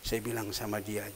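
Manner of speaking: ordinary delivery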